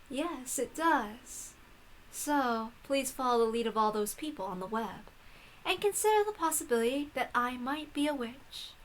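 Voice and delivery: hushed, knowing witch voice; a calm witch, barely above a murmur; soft witch author. Acted. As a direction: quietly invite her to believe. Hachijo claims to be witch.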